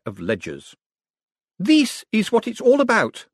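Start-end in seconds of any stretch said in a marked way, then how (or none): 1.6-3.3 much higher-pitched proclamation; high, ringing vindication; triumphant announcement. A narrator's reading. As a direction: declare it in triumph. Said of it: "she announced triumphantly".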